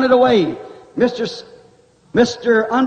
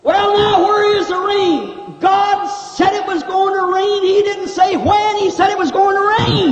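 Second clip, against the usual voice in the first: Noah's retort; 6 semitones higher than usual. Acted. Noah answers the scoffers.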